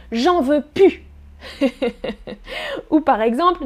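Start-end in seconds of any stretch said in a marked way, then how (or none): 0.1-1.0 "plus" said "pu", no L sound left; casual pu. Shown as a demonstration. Teacher shows very casual talk with friends. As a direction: say "J'en veux plus" as "j'en veux pu". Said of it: "on peut même prononcer pu".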